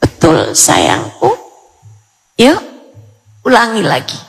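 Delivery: very low, tender praise; tender mother. Acted. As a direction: approve your child softly, pitched right down. Mother praising her child. (suara ibu lembut dan rendah)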